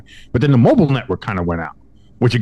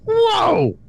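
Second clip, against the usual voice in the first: a high, put-on startled whoa. Customer reacting to the agent's surprise tech booking, high mock shock. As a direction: recoil in mock shock at the offer. Taken aback by the tech offer.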